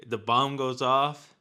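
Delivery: ordinary talk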